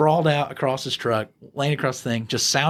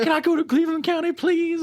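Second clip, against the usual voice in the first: mock crying. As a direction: imitate a drunk man crying and begging. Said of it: "As he's, like, crying".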